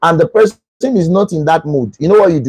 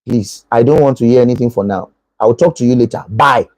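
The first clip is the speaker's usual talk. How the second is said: tired dismissal; 7 semitones lower than usual. Acted. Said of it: "still better than".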